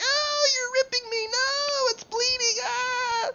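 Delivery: very high, wailing victim voice; hammed-up squeal of a small creature in pain; pleading creature. Game master voices fungus being torn.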